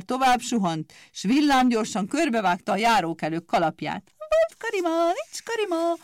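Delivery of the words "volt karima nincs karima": very high, sneering scissors voice; mocking grin. Scissors taunting the passers-by about their hats, in a very high sneer. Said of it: "vigyorgott gúnyosan".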